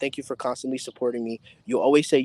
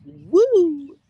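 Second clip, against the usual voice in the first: high, loud whoop of joy; excited woo; 12 semitones higher, 7 dB louder than usual. Real, not acted. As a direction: whoop it out, thrilled. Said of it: "I keep trying to show excitement".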